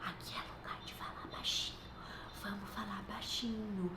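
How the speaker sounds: put-on soft whisper to a child; whispering "vamos falar baixinho" in church. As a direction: hush the child gently and playfully. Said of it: "a gente fala".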